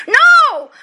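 loud, high exclaimed no; emphatic refusal. Acted. Teacher scorns the imagined happy family, emphatic refusal. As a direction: burst out with an emphatic objection.

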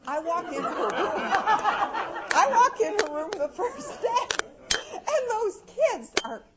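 laughing high and loud, slowed right down. Real, not acted. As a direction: laugh your way through the memory.

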